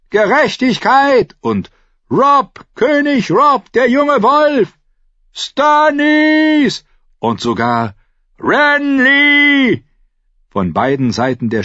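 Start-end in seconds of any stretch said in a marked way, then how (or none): 0.1-1.3 high, slow rallying cry; crowd shout. A narrator's reading. Rioters demanding justice, shouting. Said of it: "hörte er ein paar Rufe wie". 2.1-6.8 high, loud rallying cries; crowd shouts. A narrator's reading. Rioters hailing rival kings, shouting. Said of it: "hörte er ein paar Rufe wie". 8.4-9.8 high, loud drawn-out cry; crowd shout. A narrator's reading. Rioter hailing a rival king, shouting. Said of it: "hörte er ein paar Rufe wie".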